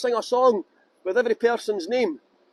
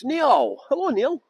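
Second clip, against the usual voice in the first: normal talking voice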